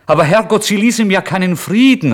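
a desperate, overwrought cry of protest; beside himself. Acted. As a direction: cry out, losing all control. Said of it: "rief ich außer mir".

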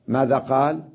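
ordinary talk, nothing marked

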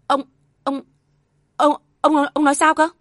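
high, loud, stammered repeated word; stammering shock. Acted. Wife stammering at her husband's revelation, stunned. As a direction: stumble over the word in shock. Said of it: "bà mới lắp bắp".

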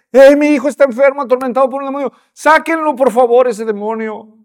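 a father crying out for help; pleading father. Retold or imitated. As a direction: beg them to heal your son. Desperate father pleads to apostles.

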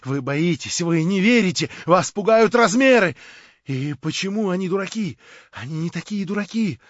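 high, loud feverish rant; frenzied tirade. A narrator's reading. Verkhovensky raving at Stavrogin in a frenzy.

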